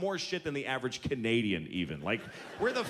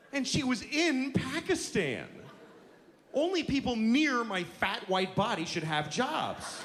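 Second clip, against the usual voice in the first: mock bigoted whine; 8 semitones higher, slower than usual. Voicing bigoted American.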